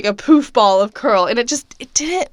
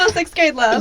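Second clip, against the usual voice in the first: laughing tease; 7 semitones higher, slower than usual. Real, not acted. Teasing friend's story.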